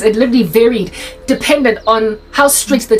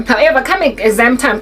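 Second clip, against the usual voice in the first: ordinary talk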